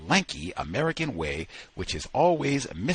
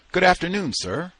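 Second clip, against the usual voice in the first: higher-pitched, lightly voiced Southern greeting; Southern accent; 9 semitones higher, 5 dB louder than usual. A narrator's reading. Old American greets the dog's keeper, lightly Southern accent. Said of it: "I love that Southern accent".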